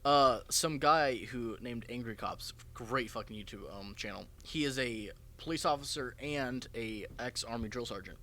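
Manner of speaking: usual speaking voice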